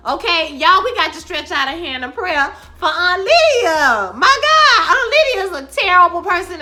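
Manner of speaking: high, loud laughing call to prayer; laughing outcry. Real, not acted. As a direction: cry out through laughter.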